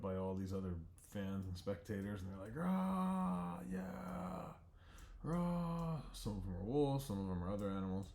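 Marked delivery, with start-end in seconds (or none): 2.6-6.0 high cheering crowd voice. Acted. The arena crowd cheers the fighters, high whoops. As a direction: whoop like a stadium of fans.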